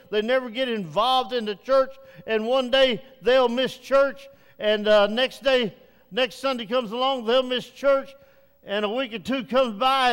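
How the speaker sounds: full preaching. Real, not acted. Pastor urging the congregation to join Bible study, preaching loudly. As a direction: drive the invitation home from the pulpit.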